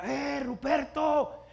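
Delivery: high, loud, stretched-out holler; calling shout. Acted. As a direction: yell the name like a dad calling. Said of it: "O te grita".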